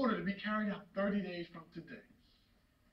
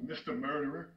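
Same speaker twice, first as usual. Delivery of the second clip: low, soft, scornful address; bitter contempt; 9 semitones lower, 10 dB quieter than usual. Acted. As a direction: drop down on the insulting name. Bitter name for his son.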